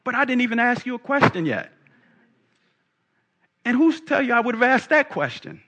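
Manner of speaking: high-pitched, put-out objection; indignant Nicodemus. Acted. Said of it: "I can imagine Nicodemus going".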